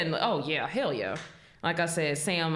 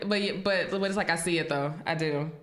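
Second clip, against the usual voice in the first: ordinary delivery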